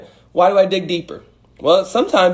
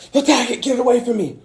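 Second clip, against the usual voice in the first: much higher, panicky mock command; mock panic fight; 10 semitones higher, faster than usual. Acting out fight response to a spider, high mock panic. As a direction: play someone lashing out at a spider. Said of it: "it's like".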